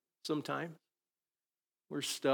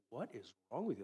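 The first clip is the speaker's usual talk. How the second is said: dog's puzzled look; 11 dB quieter, faster than usual. Retold or imitated. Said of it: "the dog's looking at me like".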